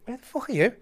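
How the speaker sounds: high-pitched incredulous quote; lightly acted high call down the phone; mock phone yell. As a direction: imitate a baffled caller, pitched right up. Manager on phone over wind noise.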